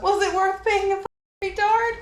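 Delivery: angry shout. Real, not acted. Repeats the insult.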